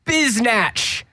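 hammed-up British accent calling the House to order. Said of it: "That was my British accent".

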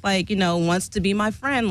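ordinary talk, nothing marked

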